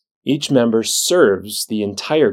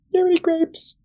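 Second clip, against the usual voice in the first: very high little duck voice; faster than usual. Acted. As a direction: pipe it up high like a tiny duck. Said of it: "walks up to the bartender".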